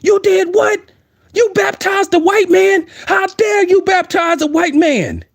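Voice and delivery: outraged movie character. Retold or imitated. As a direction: imitate a scandalized man crying out in disbelief. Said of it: "Just like that dude on The Birth of a Nation".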